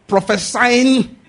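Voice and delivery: prophesying drawn out long and loud; drawn-out preaching. Acted. Preacher thundering about the prophet's message. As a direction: stretch prophesying out slow and high.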